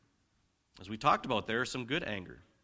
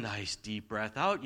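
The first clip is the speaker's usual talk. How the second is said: slow, lower "nice deep breath out"; breathing-out demo; 5 semitones lower, 3 dB quieter, slower than usual. Shown as a demonstration. Preacher guiding parents through a calming breath out, slow and low. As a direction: let "out" sink like a released breath. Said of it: "taking a breath".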